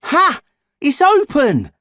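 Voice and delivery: very high, loud cry; thrilled. A narrator's reading. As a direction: burst out with glee. Boy thrilled door opens.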